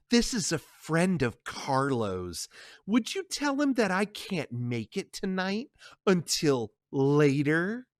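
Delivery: slow, sly woman's voice; the other woman taunting Connie over the phone, slow; smug mistress. Acted. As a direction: tease the wife without saying it. Mistress calls Carlo's home.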